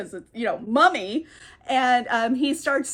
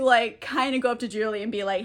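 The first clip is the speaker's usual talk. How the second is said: ordinary delivery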